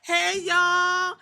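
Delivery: high, loud singsong greeting; mock sweet greeting. IYO SKY calling out to her backstage crew in a high, bright singsong. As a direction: greet them with fake sweetness. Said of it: "E O was like".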